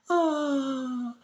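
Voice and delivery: high, awed mock gasp and sigh; gasp, then a high adoring oh; imitating gasp. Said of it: "she's looking at him like".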